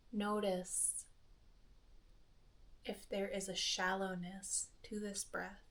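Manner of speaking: soft, hushed meditation voice with long pauses; quiet, spacious delivery, letting each phrase settle; guided meditation. Acted. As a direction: gently guide a listener into breath awareness, softly and unhurriedly.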